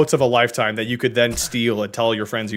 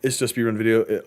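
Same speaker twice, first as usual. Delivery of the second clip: ordinary talk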